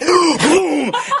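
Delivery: loud, high "hoo-hoo" howl; creature wail. Acted. Game master as Laomi zooming away, high wailing howl. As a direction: howl like a fleeing monster. Monster wails as it flees.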